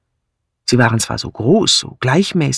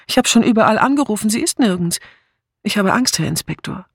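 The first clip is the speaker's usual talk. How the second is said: higher, hurried worried voice; childlike fear; 8 semitones higher, faster than usual. A narrator's reading. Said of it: "war ein kindliches Zittern".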